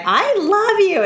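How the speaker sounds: put-on high, gushing drunk voice. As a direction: gush it like a tipsy friend.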